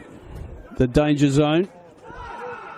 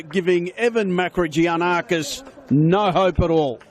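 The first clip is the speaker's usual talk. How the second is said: excited commentary; 4 semitones higher than usual. Real, not acted. Post-goal excited commentary.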